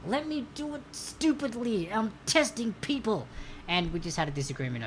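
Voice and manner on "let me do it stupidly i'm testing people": high, whiny comeback; hammed-up protest, pitched up; mock-exasperated retort. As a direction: argue back in playful frustration. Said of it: "And I'm like".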